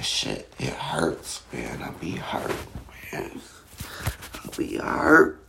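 normal talking voice

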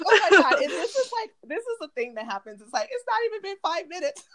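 high, laughing, teary giggle; real laughing embarrassment over early tears; laughing through tears. Real, not acted. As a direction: laugh at yourself for crying already. Laughing at crying so soon.

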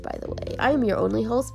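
ordinary talk, nothing marked

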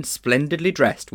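plain reading voice

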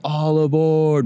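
high, slow, stretched-out call; mock loud yell. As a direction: draw it out high like a retold holler. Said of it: "really loud in the car".

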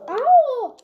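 sharp loud "Au!" from a sudden hit; real pained yelp. Real, not acted. Player hit by a wild boar, yelps loudly in pain.

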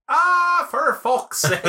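high, exasperated mock Irish; drawn-out Irish groan of exasperation; Irish accent. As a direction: wail it in a hammed-up Irish accent. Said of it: "the only thing I can say in Irish".

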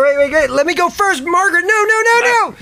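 high, climbing panic; frantic pleading. Acted. Frantically stopping Margaret from rushing up, panicked.

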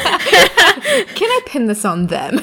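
high, slow, laughing mock scheme; laughing joke. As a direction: giggle it as a sly plan. Joking continuation, laughing.

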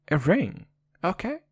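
usual speaking voice

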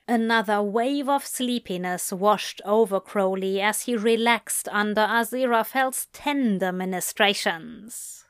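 plain reading voice

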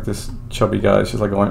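ordinary talk, nothing marked

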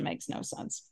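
breathless whisper. Real, not acted. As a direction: let it fade to a whisper. Trailing off.

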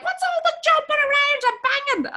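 high, complaining neighbour voice; mock upstairs neighbour. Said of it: "your woman upstairs is like".

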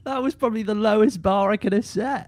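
high-pitched, laughing self-mockery. Real, not acted. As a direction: laugh helplessly through the words. Laughing at own bad impression.

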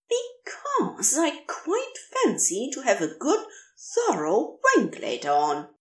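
high, prim voice of the angel, primly matter-of-fact; high prissy angel, coolly honest; prim fussy angel. A narrator's reading. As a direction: say it as a proper, fussy gentleman would. Narrator voices Aziraphale.

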